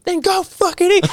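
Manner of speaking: very high, hammed-up yell; mock furious shout. Retelling the casino fight, mock furious shout. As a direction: fling the line as a shout. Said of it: "retold shout".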